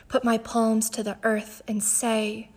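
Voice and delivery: ordinary delivery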